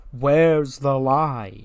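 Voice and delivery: slow, stretched-out mock taunt; a fan echoing the taunt, slow and teasing; drawn-out taunt. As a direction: drag the catchphrase out to needle the crowd.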